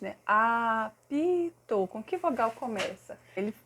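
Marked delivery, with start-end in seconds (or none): 0.3-1.9 "Apito" stretched very slowly, each syllable apart; patient teacher, syllable by syllable. Shown as a demonstration. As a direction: draw out "Apito" so the child hears the A. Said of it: "a gente vai falando pausadamente".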